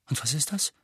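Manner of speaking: ordinary delivery, nothing marked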